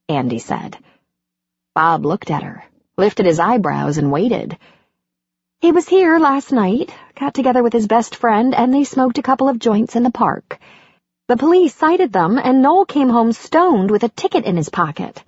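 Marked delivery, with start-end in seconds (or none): 5.6-15.2 lightly voiced fed-up mother; Andy's voice. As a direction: vent about your son, pitched up. Andy tells Bob about Noel.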